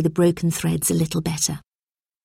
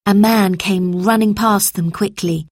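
ordinary delivery, nothing marked

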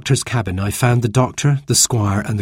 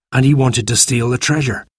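ordinary delivery

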